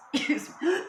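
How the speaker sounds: real choking cough; coughing, went down the wrong pipe; coughing up water. Real, not acted. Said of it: "it went down the wrong pipe".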